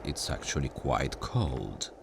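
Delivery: usual speaking voice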